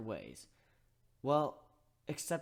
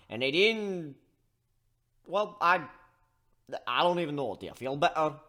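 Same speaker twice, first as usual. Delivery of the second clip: booming, higher coach-like voice; gruff mediator; 5 semitones higher, 8 dB louder than usual. Acted. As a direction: talk down to squabbling kids loudly. Steelhorn voiced addressing the kids.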